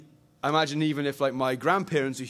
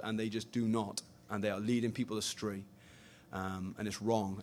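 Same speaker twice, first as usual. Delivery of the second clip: emotional; 7 semitones lower, 10 dB quieter than usual. Real, not acted. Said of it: "I'm already too emotional".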